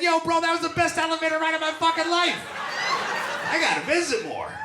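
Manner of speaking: loud, high, fast shout; excited bragging. Acted. Telling his brother about the elevator ride, thrilled shouting. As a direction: gush at full volume, racing and high.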